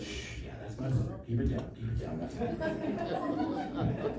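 whispered, embarrassed shushing; a light mock of siblings shushing; hushed aside. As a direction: hide the brother, keep it hushed. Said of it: "they grew up with".

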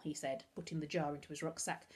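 plain reading voice

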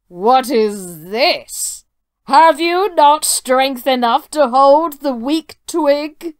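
slow booming giant challenge. Acted. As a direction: boom it out like a proud giant. Giant mocks the tailor.

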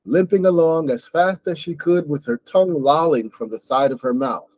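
ordinary talk, nothing marked

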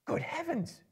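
high startled exclamation; a hobbit's high, startled cry; exclaimed. Acted. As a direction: squeak it out in sudden surprise. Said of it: "he exclaimed".

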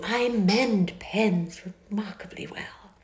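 slow, whispered flattery; breathy admiring whisper. A narrator's reading. Miss Bingley flattering Darcy.

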